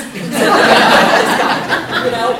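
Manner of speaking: loud, airy laugh. Real, not acted. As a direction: crack up at the joke. Laughing at own joke.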